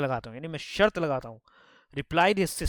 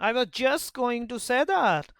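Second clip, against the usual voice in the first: high, very slow, drawn out; smug brother; 6 semitones higher, 3 dB louder, much slower than usual. Acted. Brother smugly claiming his sister's idea. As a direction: drag it out like a smug kid.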